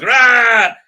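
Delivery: a loud hammed-up monster growl; mock growl. Reviewer voicing the creature jumping out, growling. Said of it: "so he growls".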